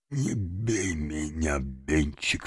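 slow, very soft plea; subdued pleading. A narrator's reading. Old father backs away from his son, subdued pleading. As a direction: beg weakly, barely above a murmur.

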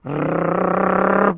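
long rolled growl; trilled beastly rrr; animal growl. A narrator's reading. Growl from the cages as the cat approaches. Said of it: "послышалось из клеток".

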